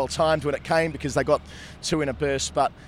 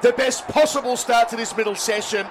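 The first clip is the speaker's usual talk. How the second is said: live play-by-play, rising at wickets; cricket commentator; 7 semitones higher than usual. Acted. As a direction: call the ball-by-ball action live.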